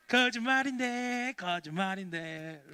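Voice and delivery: slow, drawn-out sing-song taunt; teasing prankster. Acted. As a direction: tease in a childish chant, dropping on the repeat. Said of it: "또 이러는 거예요".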